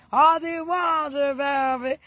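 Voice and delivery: drawn-out call. Real, not acted. Drawn-out greeting to a friend.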